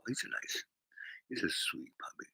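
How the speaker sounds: soft unvoiced whisper. Streamer whispering sweetly about the chat troll. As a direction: whisper it like soothing a puppy. Cooing over a troll commenter.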